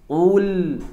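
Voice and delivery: a short, heavy ul from the back of the mouth; thick ul demo. Shown as a demonstration. Teacher models the thick ul for students, heavy and full. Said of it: "Ikuti, silakan ikuti".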